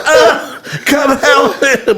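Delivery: high strained groan, then a slow plea for help; winded plea. Retold or imitated. Winded man begging fleeing friends, high strained plea. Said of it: "I was like".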